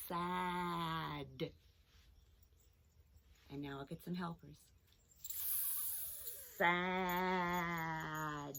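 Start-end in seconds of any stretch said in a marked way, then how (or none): 0.1-1.5 SAD stretched out, long s and vowel; said slowly. Shown as a demonstration. Teacher sounding out a word for children, slowly. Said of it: "Let's say it slowly". 6.6-8.5 "Sad" with long hiss and held vowel; said slowly. Shown as a demonstration. Teacher counting sounds with helpers, very drawn-out. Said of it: "Let's say it slowly".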